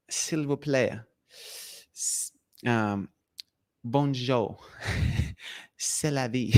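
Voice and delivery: soft, quiet French phrases; mock French charm, silky and quiet; French accent. Shown as a demonstration. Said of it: "French is like silk".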